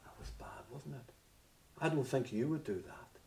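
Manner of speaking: hushed whisper to the listeners; whispered aside. Acted. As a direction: lean in and whisper it. Aside to congregation.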